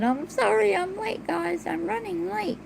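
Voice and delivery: high, sing-song sorry; high apologetic voice. Retold or imitated. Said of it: "I said".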